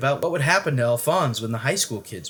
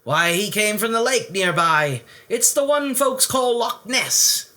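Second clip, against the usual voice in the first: higher Scottish uncle's lilt; fond old Scot on the phone; Scottish accent, kindly; 8 semitones higher, 4 dB louder than usual. Acted. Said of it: "he said".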